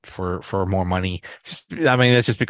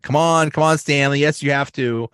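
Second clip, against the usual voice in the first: pushy pleading; 6 semitones higher, 4 dB louder than usual. Retold or imitated. Michael pushing Stanley to comment.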